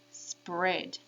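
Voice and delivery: normal talking voice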